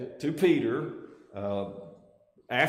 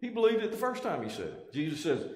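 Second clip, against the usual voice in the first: ordinary delivery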